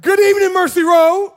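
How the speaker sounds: rally the room with a big shouted greeting; loud greeting call. Shown as a demonstration. Pastor calls out to the congregation with a loud greeting. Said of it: "get ready for the second time".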